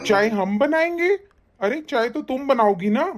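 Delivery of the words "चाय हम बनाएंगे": high, incredulous question rising at the end; indignant disbelief. Acted. In-law is ordered to make the tea, indignant disbelief. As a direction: throw it back in disbelief, pitched up.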